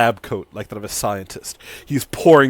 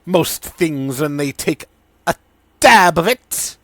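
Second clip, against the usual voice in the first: mad scientist Sidon; 5 semitones higher, 7 dB louder than usual. Acted. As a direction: warn with gleeful menace.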